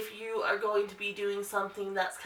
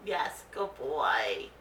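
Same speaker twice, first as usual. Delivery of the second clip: slow, much softer praise; 7 semitones lower, 3 dB quieter, much slower than usual. Real, not acted. Owner praising her nervous dog, slow soft praise. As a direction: draw the praise out gently.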